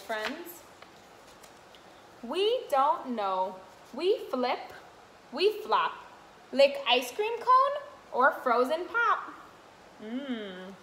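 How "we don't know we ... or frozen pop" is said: sing-song picture book read; reading to children. Acted. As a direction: read the rhyme brightly to little ones. Continues reading rhyming book.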